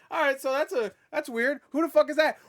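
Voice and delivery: high, rising incredulity; mock bafflement. Viewer puzzled by the stranger in the portal, baffled. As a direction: build to a loud puzzled outburst.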